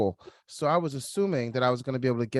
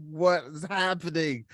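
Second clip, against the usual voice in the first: bewildered disbelief; 3 semitones higher, 3 dB louder than usual. Retold or imitated. Stunned by the coach's verdict.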